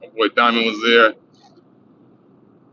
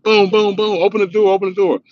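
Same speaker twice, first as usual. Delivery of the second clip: high boom then open the door; police banging; 9 semitones higher than usual. Retold or imitated. Cop banging and ordering the door opened, high.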